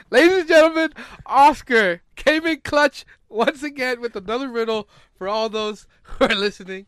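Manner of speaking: loud, high hype-man announcement; booming ringmaster-style introduction; show announcer. Pretend. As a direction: announce it big to the crowd. Hypes the riddle to podcast listeners.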